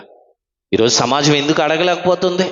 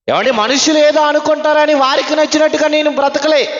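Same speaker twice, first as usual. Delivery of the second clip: normal talking voice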